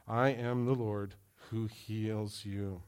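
slow hushed repeat. Shown as a demonstration. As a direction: linger on each word. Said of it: "That's key for this".

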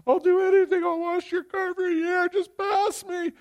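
high pleading voice, slowing at the end; hammed-up begging student; mock desperate pleading. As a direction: beg the teacher in mock panic. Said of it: "I'm almost in tears".

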